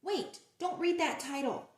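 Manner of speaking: plain reading voice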